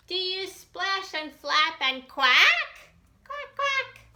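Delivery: high, bright quacking question; playful duck. Acted. Reader asking the child in a high duck-like voice. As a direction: ham it up like a duck.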